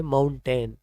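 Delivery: माउंटेन with a full "tain" ending, "moun-TEN"; wrong pronunciation. Shown as a demonstration. Teacher shows learners a common mistake, wrong pronunciation. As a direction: rhyme the end of माउंटेन with "ten". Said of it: "गलत pronunciation में बोल देते हैं".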